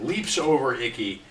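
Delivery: ordinary talk, nothing marked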